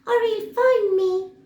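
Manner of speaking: very high, loud squeaky mouse; a tiny mouse shooing him off; squeaky little voice. Acted. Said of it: "said a squeaky little voice".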